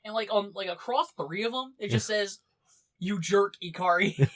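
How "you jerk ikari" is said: loud, scolding insult read out with a laugh; mock angry. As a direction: spit it out like a petty insult. Reading an insult scrawled on notes.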